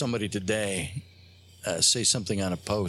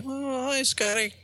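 put-on high-pitched crinkly old-man voice; slower than usual. The doll greets Scotty on a recording, crinkly old man. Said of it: "like this crinkly old man".

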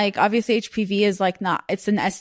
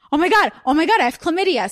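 higher, louder mock panic; retold freak-out on a higher pitch; panicked; 7 semitones higher, 4 dB louder than usual. Friend retelling finding out she has chlamydia, mock panicked.

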